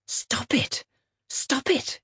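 hushed, breath-only plea to stop; urgent whisper. Acted. Liz telling herself to stop the visions, urgent whisper. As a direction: whisper it sharply to yourself.

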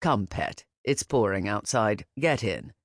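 lightly voiced caring older man; Lucian, kind. A narrator's reading.

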